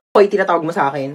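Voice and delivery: ordinary delivery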